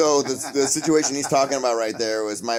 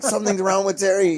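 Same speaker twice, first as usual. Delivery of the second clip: worried; 9 semitones higher, 3 dB louder than usual. Retold or imitated. Retold worried call.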